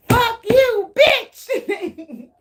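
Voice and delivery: high, loud mock fury; hammed-up squealed curse, breaking into laughter; mad person cursing. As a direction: play an enraged person, laughing. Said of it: "They is mad".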